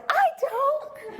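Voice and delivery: very high, strained mock protest; talked-out voice. Artist disagreeing that her voice is cool, strained. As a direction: squeeze it out high, imitating a lost voice. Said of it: "So I was talking like this".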